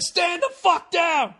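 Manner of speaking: high, slow barked order through a laugh; a high, slow hammed-up command shout; mock commanding shout. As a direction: yell it like a drill sergeant at himself. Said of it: "where you just shout your dick".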